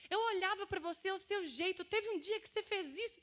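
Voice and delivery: high, pleading outpouring; retold tearful confession. Friend confessing her hurt on an audio, imitated tearful plea. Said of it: "começou a chorar e falou".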